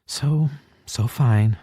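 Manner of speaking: high, slow, wobbling forced cheer; pushing through tears with a smile; forced brave smile. A narrator's reading. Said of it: "forces herself into a smile".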